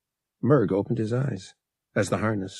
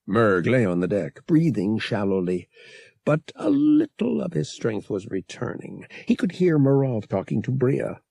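usual speaking voice

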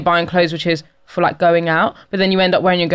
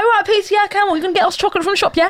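put-on cheeky teen taunt, higher and fast; cheeky mock; 9 semitones higher, faster than usual. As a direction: tease the officer like a bold schoolkid. Kids mocking a policeman.

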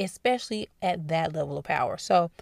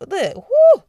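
excited whoo; 14 semitones higher, 16 dB louder than usual. Real, not acted. Exclaiming about Biden aging.

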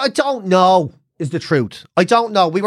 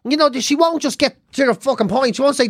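normal talking voice

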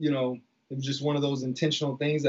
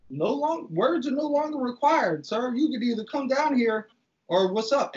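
much higher, heated dare; challenging threat; 6 semitones higher than usual. Retold or imitated. Retelling squaring up to Frank on the stairs, heated dare. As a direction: throw down the challenge.

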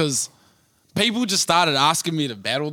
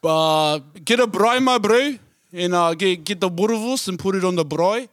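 put-on South African accent. Trying a South African accent at a friend's request, lightly voiced. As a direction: try out a South African accent about braai and boerewors. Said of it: "I'll try to do a South African accent".

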